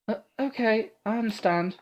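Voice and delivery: put-on high, eager girl's voice; awed young heroine praising her protector; timid young girl. Awed Ada gushing at Martha, high and breathless.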